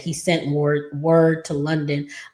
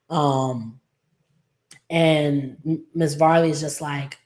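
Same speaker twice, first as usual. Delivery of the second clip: ordinary talk, nothing marked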